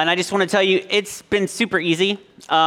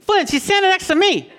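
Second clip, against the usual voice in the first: high, squeaky replay of himself; mock himself as a frazzled dad; mock exasperated dad; 13 semitones higher than usual. Dad retelling telling his son, high comic squeak. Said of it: "it's like".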